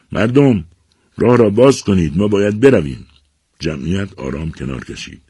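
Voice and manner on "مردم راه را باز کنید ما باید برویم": loud, high call to a crowd; shouted order. A narrator's reading. Chief of servants ordering the crowd aside, shouting. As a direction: shout to clear the way. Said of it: "سرکردهٔ غلامان فریاد کشید".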